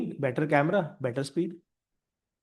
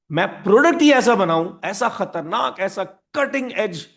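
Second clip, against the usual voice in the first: higher-pitched, emphatic bravado; bold company boast; 4 semitones higher, 6 dB louder, slower than usual. Acted. Company dismisses customers to push its own product, bold boast. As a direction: declare it with swaggering conviction.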